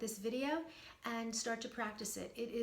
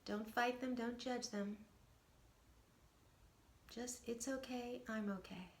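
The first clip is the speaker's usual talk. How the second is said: guided meditation; 10 dB quieter than usual. Acted. Coach guiding viewers through a short meditation, soft and calm. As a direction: guide them gently into stillness.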